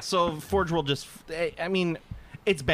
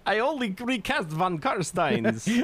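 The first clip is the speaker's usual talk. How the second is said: put-on slow, high Transylvanian accent; hammy Transylvanian vampire drawl; 7 semitones higher, slower than usual. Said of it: "sounds like a Transylvanian man".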